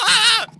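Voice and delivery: a frightened little shriek; panicked yelp. Acted. Panics about not being born.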